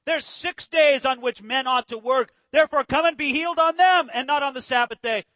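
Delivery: loud, slowed, scolding proclamation; very indignant. Acted. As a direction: lay down the rule to the crowd, affronted. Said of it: "he says very, uh, indignantly".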